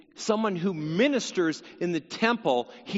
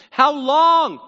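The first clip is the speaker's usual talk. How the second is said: a loud, high cry of "How long"; loud high lament; cry to God; 7 semitones higher, 10 dB louder than usual. Acted. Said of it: "This is a cry".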